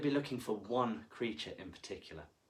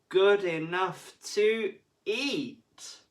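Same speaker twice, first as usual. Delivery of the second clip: slow, high and tempting; slow drawn out; 9 semitones higher, 8 dB louder, much slower than usual. A narrator's reading. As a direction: draw out the temptation. Teacher praises painting.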